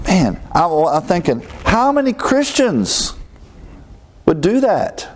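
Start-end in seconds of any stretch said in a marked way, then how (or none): 1.6-5.1 high, slowed disbelief on how many; incredulous. Real, not acted. Preacher asking the congregation, high incredulous. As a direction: marvel that anyone could.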